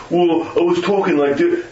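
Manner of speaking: mock eighties-movie Brooklyn tough guy; hint of Brooklyn swagger; Brooklyn accent. Shown as a demonstration. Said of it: "everybody in the world's from Brooklyn".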